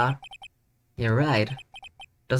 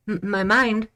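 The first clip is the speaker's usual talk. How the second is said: normal talking voice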